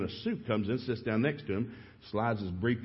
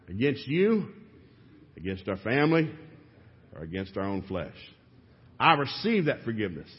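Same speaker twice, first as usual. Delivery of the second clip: preacher leading vows. Shown as a demonstration. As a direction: lay out each vow for the men to echo. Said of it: "say this after me".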